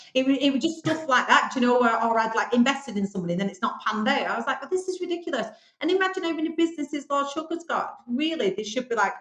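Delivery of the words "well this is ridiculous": high-pitched incredulous protest; retold indignation. Speaker recalls reacting to the interview, indignant.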